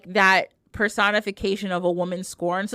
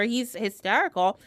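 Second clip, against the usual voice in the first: ordinary delivery, nothing marked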